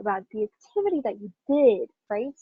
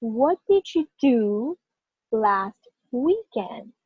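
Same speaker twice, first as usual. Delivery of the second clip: very slow, stressing "What", "do", "last" and "weekend"; emphatic model for repetition; much slower than usual. Shown as a demonstration. Teacher leads students repeating after her, slow stress on "do" and "weekend". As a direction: draw out "do", "last" and "weekend", pausing between them. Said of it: "can you repeat after me?".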